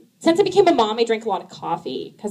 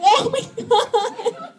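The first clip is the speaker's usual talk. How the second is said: shocked gasp; 13 semitones higher, much slower than usual. Retold or imitated. Shocked at vomit in hands.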